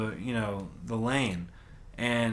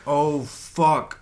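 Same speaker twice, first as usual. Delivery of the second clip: retold oh fuck; 3 semitones higher, 6 dB louder than usual. Reacting before crash, retold.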